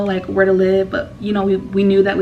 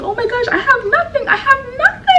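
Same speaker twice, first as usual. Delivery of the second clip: pitched way up, half laughing, imitating a sob; mock wailing despair. Answering Alex's comfort with imitated tearful despair. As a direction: replay the meltdown as a high teary wail. Breaking down to friends.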